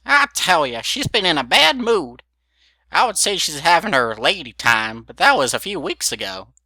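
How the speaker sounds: put-on high, loud cackly old mare; old granny voice. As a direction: play a nosy old grandmother. Granny gossiping.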